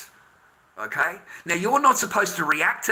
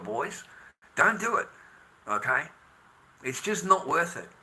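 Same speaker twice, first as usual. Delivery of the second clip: ordinary talk, nothing marked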